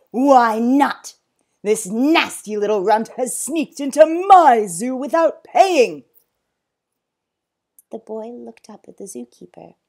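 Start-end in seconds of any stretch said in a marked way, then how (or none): 0.1-6.0 very loud, indignant rant; angry outburst. Acted. Zoo owner accusing boy of sneaking in, angry. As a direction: rage with raised pitch. Enraged owner rants at zookeeper.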